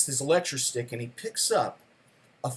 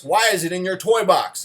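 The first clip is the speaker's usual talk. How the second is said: louder, higher stern question; stern father; 4 semitones higher, 6 dB louder than usual. Retold or imitated. Father questioning his son about the toy box, stern and louder. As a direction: demand an answer like a military dad.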